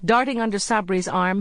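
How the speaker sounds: usual speaking voice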